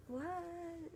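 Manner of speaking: high, thin disbelieving what; a squeaky, incredulous question; baffled what. Retold or imitated. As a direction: act stunned and confused. Baffled reply to hospital staff.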